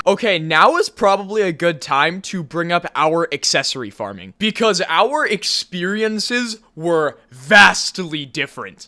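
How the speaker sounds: ordinary talk, nothing marked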